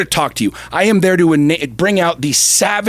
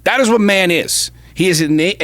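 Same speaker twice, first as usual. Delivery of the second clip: normal talking voice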